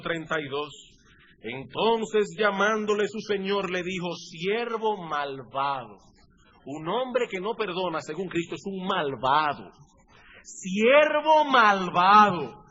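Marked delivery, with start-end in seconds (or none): 4.2-6.0 slow, low, weighty verdict; stern condemnation. Acted. King condemns the servant. 10.4-12.6 very slow, drawn out and louder; solemn rebuke. Acted. King condemns, repeated forcefully.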